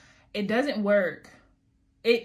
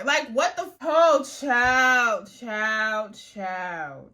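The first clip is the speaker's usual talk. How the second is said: exasperated outburst; 3 semitones higher, 6 dB louder, much slower than usual. Real, not acted. Vlogger to viewers after camera failed, exasperated cry fading. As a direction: vent the frustration then let it drain away.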